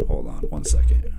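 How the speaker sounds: low whisper off-mic; whispered aside. Real, not acted. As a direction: whisper "hold on" away from the mic. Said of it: "aside whisper".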